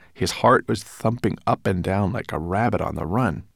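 ordinary delivery

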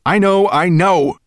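high, loud impatient snarl. A narrator's reading. Dragon to nagging dwarf servant, snarling. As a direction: snarl it to shut him up. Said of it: "snarled Nettlebrand".

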